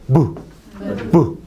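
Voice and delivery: short "bu" popped from closed lips; oclusiva b. Shown as a demonstration. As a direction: say "bu" as a brief full stop. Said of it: "los dos labios se juntan".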